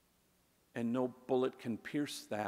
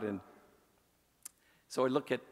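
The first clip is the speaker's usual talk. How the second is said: normal talking voice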